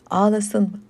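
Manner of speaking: plain reading voice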